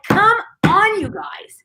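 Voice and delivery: high, loud exasperated plea; exasperated come on. Retold or imitated. Speaker fed up with leaders' failures, exasperated and loud. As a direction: throw up your hands and plead.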